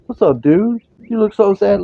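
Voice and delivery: high, loud sing-song voice for the cat; pet talk. Real, not acted. Owner cheering up his sad cat, high pet-talk voice.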